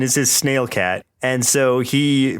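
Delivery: usual speaking voice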